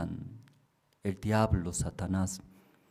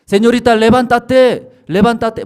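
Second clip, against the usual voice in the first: the night voice calling her more strongly; louder calling; 12 semitones higher, 14 dB louder than usual. Retold or imitated. Más fuertes.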